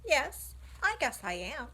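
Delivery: put-on a bright young pig owning up. Wilbur answers the sheep.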